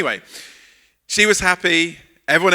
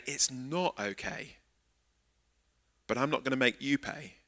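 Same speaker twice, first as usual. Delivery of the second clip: sincere forgiveness; 3 semitones lower, 13 dB quieter than usual. Shown as a demonstration. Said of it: "Forgiveness is actually saying".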